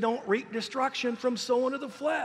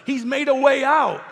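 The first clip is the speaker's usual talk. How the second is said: preaching shout; 5 semitones higher, 7 dB louder than usual. Real, not acted. Preacher proclaiming deliverance.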